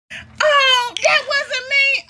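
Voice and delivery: mock denying sister.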